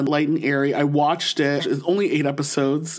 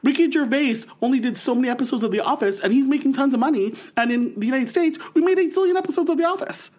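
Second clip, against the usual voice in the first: excited Tina Fey rant; 8 semitones higher, faster than usual. Retold or imitated. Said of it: "She goes".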